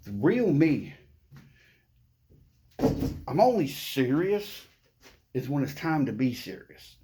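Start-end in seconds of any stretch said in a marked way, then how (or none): none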